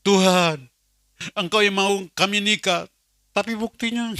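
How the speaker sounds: loud, high protesting plea; complaining prayer. Retold or imitated. Man bargains with God over marriage proof, protesting.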